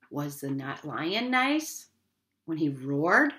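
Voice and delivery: usual speaking voice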